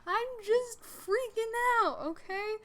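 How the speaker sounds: high, loud, drawn-out mock scream; retold screaming. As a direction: wail it at dad in imitated panic. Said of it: "I'm literally screaming at him".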